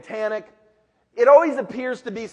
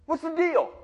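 put-on teenage David; 9 semitones higher than usual. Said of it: "He's going".

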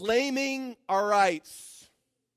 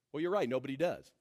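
ordinary talk, nothing marked

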